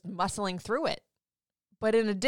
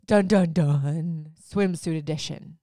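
dramatic dun dun dun; 5 dB louder, slower than usual. Acted. Teasing the swimsuit episode.

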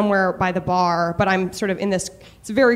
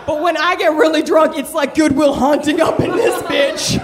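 put-on Boston accent; 4 semitones higher than usual. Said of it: "I slip into a Boston accent".

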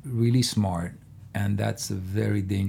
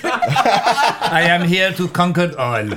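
put-on hammy, loud, high French accent; 8 semitones higher, 6 dB louder than usual. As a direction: grandly announce himself in a fake French accent. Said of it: "I can put a French accent on that too".

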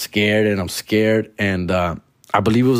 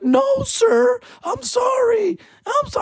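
very high squeaky panicked begging; mock scared pleading. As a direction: plead for your life in a tiny voice. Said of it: "And I'm like".

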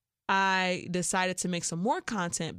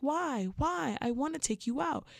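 mock whiny pleading; 8 semitones higher than usual. Mocking the man's pleading.

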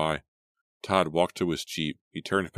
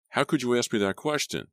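bitter snap; 8 semitones higher than usual. A narrator's reading. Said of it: "Todd snapped bitterly".

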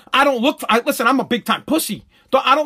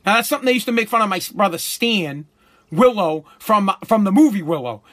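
ordinary talk, nothing marked

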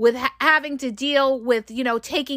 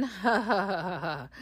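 soft low mocking laugh; 5 semitones lower, 10 dB quieter than usual. Narcissist gloating at an angry partner, soft mocking laugh. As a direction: laugh at them quietly, gloating. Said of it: "they're saying".

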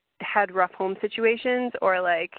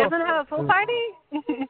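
high, chirpy party invitation; playful crayon voice; 9 semitones higher than usual. Acted. Co-host joining the crayon skit about a party, playful and high. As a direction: squeak it out as a little crayon character.

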